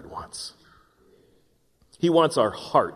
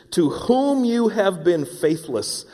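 ordinary talk

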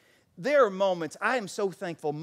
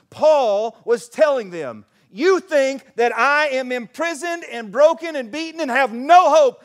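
loud, high, impassioned pulpit voice; full-force preaching; 9 semitones higher, 13 dB louder than usual. Real, not acted. Preacher proclaiming Paul's defiant hope from prison, full-force preaching. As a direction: declare it with rising force.

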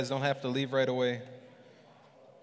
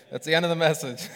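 laughing; 7 dB louder, faster than usual. Real, not acted. Jokes sermon ends, laughs.

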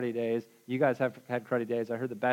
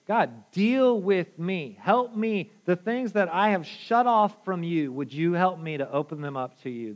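slow, pleading prayer, a touch louder; earnest prayer; 4 semitones higher, 3 dB louder than usual. Acted. Preacher voicing a prayer to God, slow and earnest. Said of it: "we have to say".

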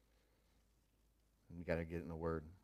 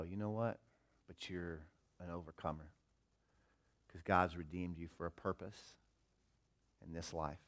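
softer, sincere pep talk to the mirror; soft self-encouragement; 3 semitones lower, 9 dB quieter than usual. Retold or imitated. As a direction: reassure yourself gently.

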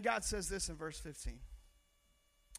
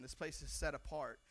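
normal talking voice

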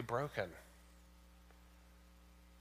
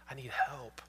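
whispered, voiceless plea for help; retold whispered plea. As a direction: breathe it out like a broken man. Broken drunk man asks for help.

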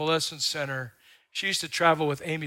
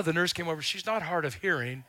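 usual speaking voice

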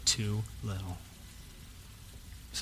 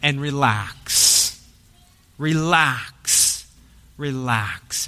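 soothing relax; 4 semitones lower, much slower than usual. Shown as a demonstration. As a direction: let each relax sink down gently. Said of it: "calming the congregation".

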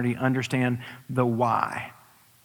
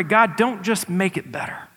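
fervent prayer; 7 semitones higher, 4 dB louder than usual. Pretend. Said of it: "what if we pray".

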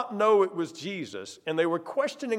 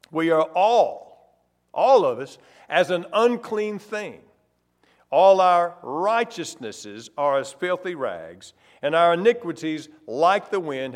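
solemn scripture reading; 5 dB louder than usual. Acted. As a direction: lean into each phrase of Isaiah. Preacher reads Isaiah verse aloud.

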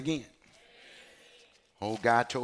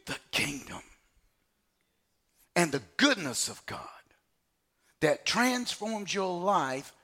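full-force preaching; 9 semitones higher, 6 dB louder than usual. Acted. Preacher pressing the congregation, loud and climbing. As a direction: thunder it at the congregation.

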